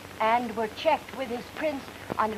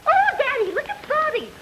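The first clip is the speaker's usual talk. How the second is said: put-on much higher, little child calling to daddy; squeaky kid piping up about the dog; child's voice; 13 semitones higher than usual. Woman acting a radio skit for a detective, as a little child.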